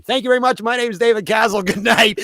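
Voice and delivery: bow out like a compere; showbiz sign-off. Retold or imitated. Comic quitting the stage, loud mock-grand goodbye.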